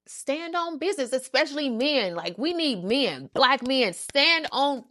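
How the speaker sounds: rally the crowd; fired-up preaching. Real, not acted. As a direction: preach it with claps. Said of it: "let me stop clapping".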